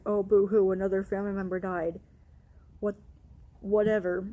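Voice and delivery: loud sneering boo-hoo, dismissive whatever; mocking boo-hoo. Devin mocking her grief, loud sarcastic sneer. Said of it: "Devin's like".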